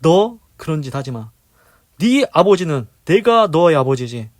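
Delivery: stern warning. Retold or imitated. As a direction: scold the wayward son firmly. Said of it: "분명히 경고할 겁니다".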